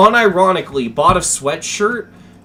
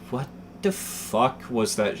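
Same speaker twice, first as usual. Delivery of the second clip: stunned inner thought; 3 semitones lower, 9 dB quieter than usual. Retold or imitated. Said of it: "the entire time I'm just like".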